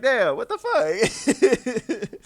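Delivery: high, loud, laughing mock outrage. Real, not acted. Streamer reacting to a dislike, laughing loudly in disbelief. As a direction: burst out laughing through the protest.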